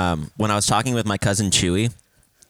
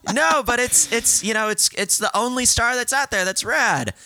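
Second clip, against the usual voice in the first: high, gushing protest; retold excited insistence; 14 semitones higher than usual. Pointing out a lone star to cousin, retold excited insistence. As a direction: insist eagerly in a raised, squeaky pitch.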